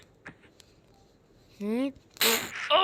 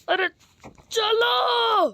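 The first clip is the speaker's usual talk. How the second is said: shouted call; 7 semitones higher, much slower than usual. Acted. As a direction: yell at them to come on. Calling the group along. (ऊँची आवाज़ में पुकार)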